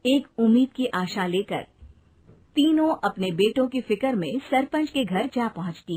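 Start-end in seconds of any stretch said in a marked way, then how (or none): none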